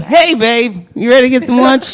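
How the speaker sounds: chirpy and awake now; bright midday voice. Retold or imitated. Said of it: "By twelve".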